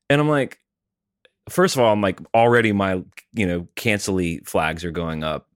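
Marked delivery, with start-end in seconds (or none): none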